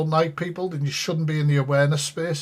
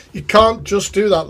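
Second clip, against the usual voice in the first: laughing; 3 semitones higher, 3 dB louder than usual. Real, not acted.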